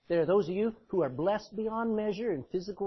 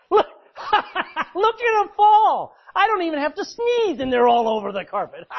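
cackling devil, much higher and louder; mocking devil; 6 semitones higher, 9 dB louder than usual. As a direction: gloat and laugh at the fallen. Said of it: "Laughing, going".

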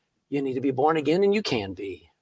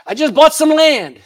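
higher, louder put-on excuse-maker; mock excuse; 11 semitones higher, 6 dB louder than usual. Invited guest begging off the dinner, mock excuse. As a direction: make a silly, high-pitched excuse.

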